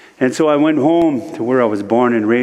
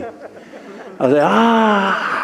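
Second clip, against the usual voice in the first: frustrated yell; 10 semitones higher, much slower than usual. Retold or imitated.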